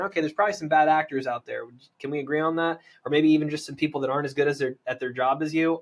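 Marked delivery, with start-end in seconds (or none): none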